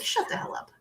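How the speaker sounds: stunned disbelieving whisper; hushed, amazed whisper. Retold or imitated. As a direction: breathe it out in shock. Stunned reply to funding news.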